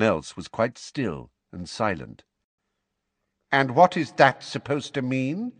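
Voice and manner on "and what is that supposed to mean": cold, raised challenge; icy. A narrator's reading. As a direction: freeze him with the question. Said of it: "Fudge asked icily".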